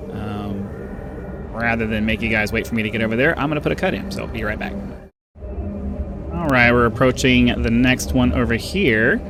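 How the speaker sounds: ordinary talk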